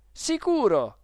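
high, loud, gleeful agreement; delighted exclamation. Acted. Boy backing the clerk against his guardian, delighted. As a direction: chime in happily. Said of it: "esclamai io tutto contento".

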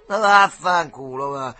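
loud, drawn-out brush-off; dismissive retort. A narrator's reading. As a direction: stretch out the curse and wave him off. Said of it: "si limitò a rispondere".

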